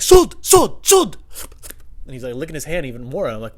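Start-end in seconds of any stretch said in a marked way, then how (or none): 0.0-1.2 loud, high one-word bursts; odd store customer. Retold or imitated. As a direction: blurt it high like a baffling customer.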